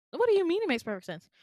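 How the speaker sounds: high, loud, fast acted protest; baffled, offended comeback; incredulous. Patient incredulous at receptionist.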